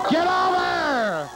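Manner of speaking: high, slow drawn-out yell; will the ball over at full voice; shouting at the ball. Real, not acted.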